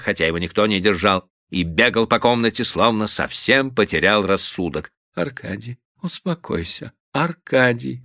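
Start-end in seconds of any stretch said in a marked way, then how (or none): 5.1-8.0 soft, much quieter begging; calm her husband gently; wife softly pleading with her husband. A narrator's reading. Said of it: "молил Софья Петровна".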